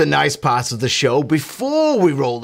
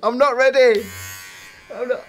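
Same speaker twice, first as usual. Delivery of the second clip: mock panic; 11 semitones higher than usual. Scared figure in the skit.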